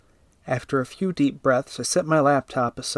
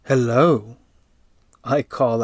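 seductive; 5 dB louder, slower than usual. Acted. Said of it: "in my most seductive voice".

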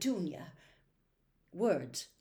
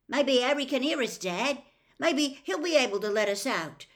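put-on slightly raised, louder boy's voice; a hopeful suggestion, pitched up a bit; lightly voiced trapped boy; 6 semitones higher, 6 dB louder than usual. A narrator's reading. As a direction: speak up from behind a wall. Trapped boy speaks up to his dad.

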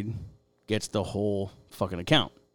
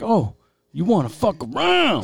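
high, loud menacing challenge; menacing threat; 10 semitones higher, 8 dB louder than usual. Retold or imitated. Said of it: "with that voice".

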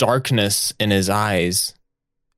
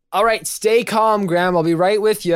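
shout; 8 semitones higher than usual. Acted. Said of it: "Harry shouts".

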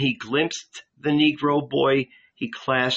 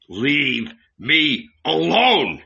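thundering; 3 semitones higher, 4 dB louder, much slower than usual. A narrator's reading. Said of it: "Jesus thundered once more".

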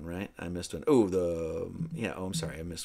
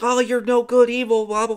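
higher mock scolding that fades out; scolding Arthur; 8 semitones higher, 5 dB louder than usual. Arthur shouts at the dragon from above, higher mock scolding. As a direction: jeer at the witch, then trail off into blah blah. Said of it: "he's like".

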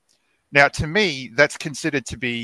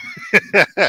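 high, bright burst of laughter; 4 dB louder than usual. Real, not acted. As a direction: laugh out loud. Laughs at Boston remark.

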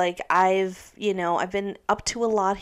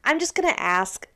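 ordinary talk, nothing marked

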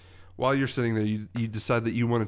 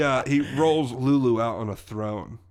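ordinary talk, nothing marked

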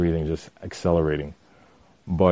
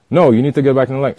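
fast, louder and higher mock order; retold bark; 6 semitones higher, 8 dB louder, much faster than usual. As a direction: snap the command like the pushy guy. Rude customer orders him back.

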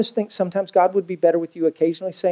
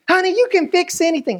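cheery wife; 11 semitones higher than usual. Acted. Wife's cheery encouragement.

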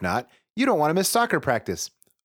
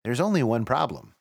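ordinary delivery

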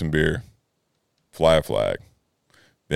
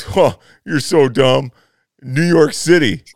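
slow, louder, higher mock condescension; smug mockery; 7 semitones higher, 7 dB louder, slower than usual. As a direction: lay on the smug know-it-all tone, slowly. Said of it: "and he goes".

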